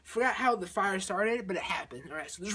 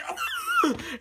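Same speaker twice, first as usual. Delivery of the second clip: very high, thin shriek; hammed-up screech; mock scream. Astronauts tumbling in space, mock screaming. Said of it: "So they're screaming".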